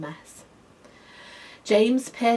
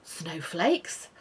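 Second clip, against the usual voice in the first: plain reading voice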